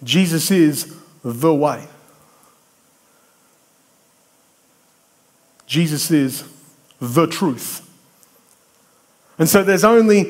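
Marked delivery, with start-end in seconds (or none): 0.0-7.8 slow, THE set apart after a short hold; stress on THE. Shown as a demonstration. Preacher showing what pops out of scripture, stressing THE. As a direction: land deliberately on "the" in each phrase. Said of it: "when I read the scripture differently".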